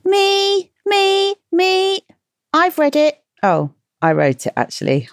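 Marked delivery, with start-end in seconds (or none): 0.0-3.2 put-on high, excited child calling out; little kid shouting me me; eager kid voice. Voicing eager kids answering.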